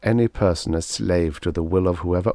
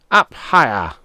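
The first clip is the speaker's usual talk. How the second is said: snapped; 4 semitones higher, 7 dB louder than usual. A narrator's reading. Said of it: "Guvek snapped".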